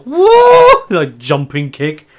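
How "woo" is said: very high, loud kung fu cry; karate yell. Acted. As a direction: yell the drunk flying kick. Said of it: "Karate Kid style".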